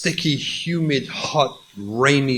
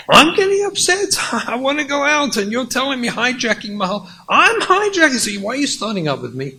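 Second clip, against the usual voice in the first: high, loud indignant back-and-forth; heated quarrel; 9 semitones higher, 3 dB louder, much faster than usual. Acted. Wife and husband trading accusations over dinner, loud and high. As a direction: fire the accusation right back.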